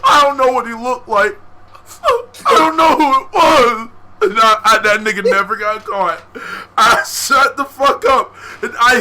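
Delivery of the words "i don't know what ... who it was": high loud tearful protest; crying denial. Retold or imitated. To the teacher asking who did it, high loud crying denial. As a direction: cry out that you know nothing.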